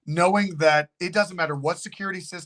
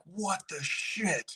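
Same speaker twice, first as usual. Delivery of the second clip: slow, hushed disbelief; stunned hush; 8 dB quieter, much slower than usual. Retold or imitated. Driver seeing an empty stroller, slow hushed disbelief. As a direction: breathe it out, quietly baffled.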